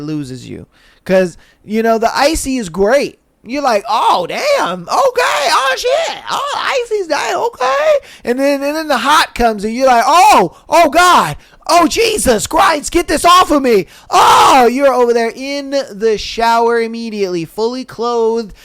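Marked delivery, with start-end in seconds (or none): 3.9-7.9 high, squeaky hammed-up yelps; mock impressed reaction. Acting out the icy first feeling. 10.1-14.7 loud, high-pitched hammed-up cries; mock panic. Acting out the hot burning.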